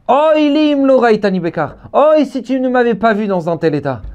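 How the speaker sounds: high, declaimed preacher's cry; acted rabbinic exclamation; impassioned retort. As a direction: answer the lament with a high, fervent cry. Acted answering cry of Rabbi Shimon.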